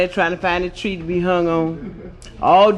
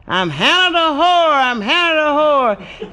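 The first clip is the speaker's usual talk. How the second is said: parrot hollering; 7 semitones higher than usual. Acted. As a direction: shout it like a brazen bird. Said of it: "started hollering".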